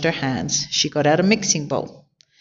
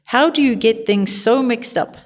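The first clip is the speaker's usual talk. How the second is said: higher-pitched storybook scolding; exclaimed reproach, not a full shout; exasperated exclamation; 7 semitones higher than usual. A narrator's reading. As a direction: ask it in mild exasperation, pitched up. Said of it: "exclaimed Missus Rogers".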